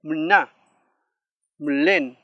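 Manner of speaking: "mna, mlen" with sixth vowel absorbed into the M; projected "mna", pause, "mlen", as model words; vowel after M. Shown as a demonstration. Teacher gives examples with M, careful loud demonstration. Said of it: "con la M".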